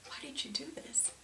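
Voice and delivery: whispered, barely audible question; wife whispering at the wreckage of her room; stunned whisper. Retold or imitated. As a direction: breathe it out in shock. Sitting on the wrecked bed.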